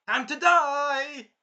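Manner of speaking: high, very loud, slow battle cry; villain's mock yell. Villain corners them on the mountain, mock villain yell. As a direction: draw it out as a hammed-up villain threat.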